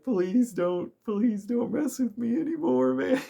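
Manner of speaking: high, whining plea, mock-scared; pleading victim. Beaten-up guy begs the heroes not to mess with him, high whiny plea. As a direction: beg them to leave you alone in a high whine. Mind-controlled guy begging to be spared.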